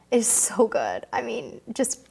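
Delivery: ordinary talk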